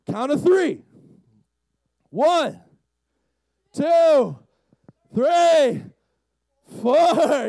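high, loud, spaced counting; stalling countdown. Acted. Peter working up nerve to step out, stalling. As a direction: shout each number, putting off the jump.

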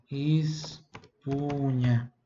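"Espunha" stretched very slowly, the NH sound held. Shown as a demonstration. Teacher demonstrates the GN sound to the student. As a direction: draw out the NH in "Espunha". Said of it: "G N, som de N H".

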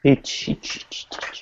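unvoiced whispered chant; whispering. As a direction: whisper the word over and over.

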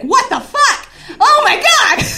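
high, loud mock freak-out; hammed-up panicked yell; shocked outburst. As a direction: shriek the second thought in comic horror. Acts out shocked second thought.

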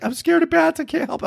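high, squeaky imitation of a frightened wife; husband mimicking his wife's whiny excuse; mock scared wife. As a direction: pitch way up to mock her fear of bats.

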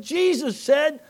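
high, loud, slow shout; shouted proclamation. Retold or imitated. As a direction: call it out the window. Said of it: "I said".

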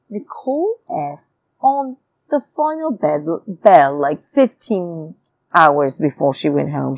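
ordinary delivery

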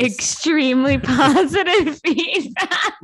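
high, loud, slow laughing echo. Real, not acted. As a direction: laugh through the words while repeating them.

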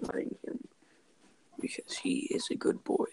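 hushed whisper so the dog sleeps. Real, not acted. Dog asleep on couch.

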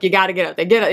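ordinary talk, nothing marked

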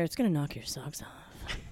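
soft teasing whisper. Real, not acted. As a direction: whisper it like a secret.

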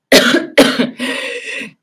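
loud mock hacking cough; exaggerated fake cough; faked cough. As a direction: cough like the sick driver. Imitating the sick man.

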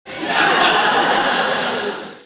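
loud breathy forced laugh on cue; fake audience laugh. Host rehearses the audience reaction, loud fake laughter. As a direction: laugh like a canned sitcom audience. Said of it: "what are you guys gonna do".

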